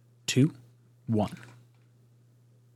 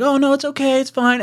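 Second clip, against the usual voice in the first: reassuring; 12 semitones higher, 3 dB louder than usual. Retold or imitated. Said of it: "I was like".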